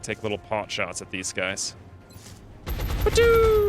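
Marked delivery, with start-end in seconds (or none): 2.7-3.6 mock gunshot. Gun sound effect.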